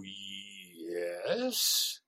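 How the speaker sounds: low, doubtful yes; a wary, held-back yes; suspicious yes; guarded yes. Shown as a demonstration. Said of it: "I don't hear you go".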